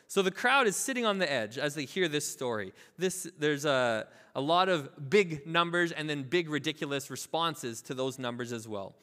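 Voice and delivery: normal talking voice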